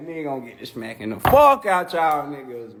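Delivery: ordinary delivery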